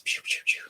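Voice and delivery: quick, soft 'pew' sound effects; playful hushed laser noises; imitated zaps. Crafter showing how fast she works, imitated zaps.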